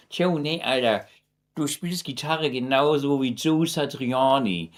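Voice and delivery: mock Hamburg accent, lightly put on; imitating a Hamburg accent. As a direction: play the Hamburg fan paying a clumsy compliment in his Hamburg accent. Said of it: "in the Hamburg accent".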